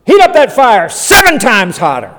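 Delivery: loud, high mock command; hammed-up royal rage; angry king. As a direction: bellow the order like a furious king. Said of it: "He's so angry, he says".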